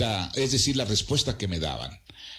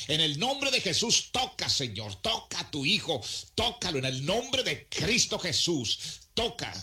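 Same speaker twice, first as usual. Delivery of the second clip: higher, calling prayer for healing; fervent prayer; 6 semitones higher than usual. Retold or imitated. Preacher praying over a despairing young man, fervent calling prayer. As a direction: call on the Lord to touch him.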